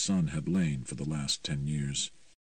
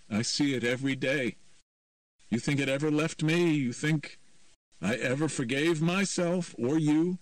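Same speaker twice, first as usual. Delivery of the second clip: put-on raised, accusing young man; bitter accusing son; 10 semitones higher, 4 dB louder than usual. A narrator's reading. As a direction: throw the blame at her, hurt. Patrick accusing his mother.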